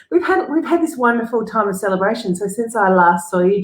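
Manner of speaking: ordinary talk, nothing marked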